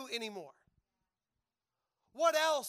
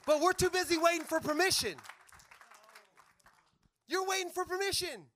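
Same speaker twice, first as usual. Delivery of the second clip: loud, higher rebuke; preaching shout; 5 semitones higher, 9 dB louder than usual. Real, not acted. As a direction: call them out with full force.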